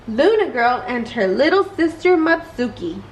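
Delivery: ordinary delivery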